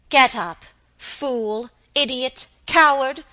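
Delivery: loud, contemptuous insults; scornful command. Acted. Mistress berating kneeling maid, loud scorn. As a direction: spit out each insult.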